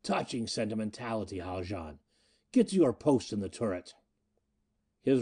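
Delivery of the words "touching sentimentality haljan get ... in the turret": sardonic. Acted. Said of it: "Miko's sardonic voice".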